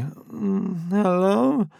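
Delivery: high, slow just-woken voice; sleepy voice on the phone. A narrator's reading. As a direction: answer like you've just woken up. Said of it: "He heard a sleepy".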